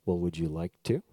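very low leaning-in pastor voice; deep, put-on elderly pastor; old pastor. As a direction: lean in low like an old preacher making an offer. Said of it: "this pastor then leans in".

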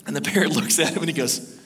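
normal talking voice

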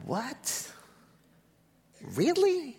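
a stunned, soaring "Really?"; jaw-dropped mock disbelief, high; incredulous. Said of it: "I'd have been like".

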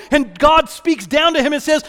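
fervent pulpit preaching; preaching at full force. Real, not acted. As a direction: preach it with rising fire.

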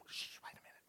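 very soft, voiceless shush; acted onlooker's whispered hush. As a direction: whisper to hush the people beside you. Onlookers hushing each other to listen.

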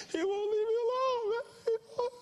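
very high wailing voice, mock sobbing; crying caller. Pastor imitating caller sobbing that Jesus won't leave him, high mock wail. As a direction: cry it out like a big old baby. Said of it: "crying his eyes out".

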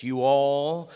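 lightly mock Texan "you all"; Texas you all. Shown as a demonstration. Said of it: "like Texas".

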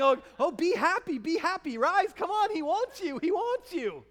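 hammed-up sudden enthusiasm; fickle cheer. Fickle crowd urging the beggar up, mock eager. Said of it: "One minute they're telling him to shut up".

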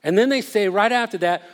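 usual speaking voice

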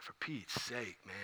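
low, very soft grumble; servant's under-the-breath complaint; muttered exasperation. Acted. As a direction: mutter it to yourself, fed up. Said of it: "what are you thinking?".

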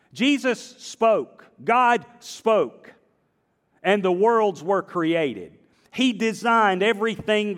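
high, slow, ringing declaration; emphatic proclamation. Real, not acted. Preacher to his congregation, ringing emphatic proclamation. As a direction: proclaim it to the whole room.

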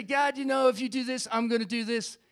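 bargaining prayer. Acted. Churchgoer trying to bargain with God, mock insistent. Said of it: "try to bargain with God".

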